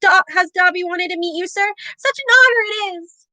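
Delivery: squeaky high house-elf cry; a tiny creature squealing with awe; high-pitched elf voice. A narrator's reading. House elf greeting Harry in awe, high-pitched squeak. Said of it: "in a high-pitched voice".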